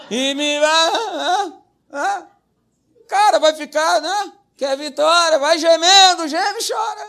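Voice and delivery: a hint of hammed-up groaning, high; mock the moaning of self-pitying believers; mock wailing. Pastor mocking believers who moan for victory.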